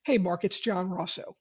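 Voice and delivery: relaxed pause; calm with a pause. Shown as a demonstration. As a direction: let "Mark," hang before going on. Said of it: "with a little bit of a pause".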